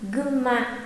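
"gumma" with a doubled m sound; N turned to M. Shown as a demonstration. Teacher models the changed sound for learners, "gumma" with M. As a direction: hold the M in the middle of "gumma". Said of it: "biến chữ ừn thành chữ M".

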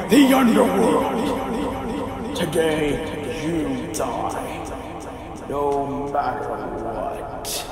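a god in his own domain; booming god voice. Acted. Underworld god answers his brother.

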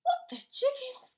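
high, loud shocked gasp; little bees gasping in shock. Acted. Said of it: "gasped all the little bees".

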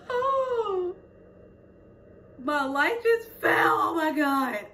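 shocked as the light crashes down; startled. Real, not acted. Vlogger's selfie light falls, startled cry.

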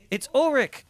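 high, loud excited call-out. Real, not acted. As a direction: shout the name in delight. Recognizing game character.

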